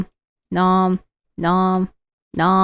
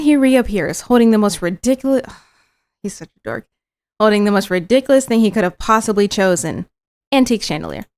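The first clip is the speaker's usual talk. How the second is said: normal talking voice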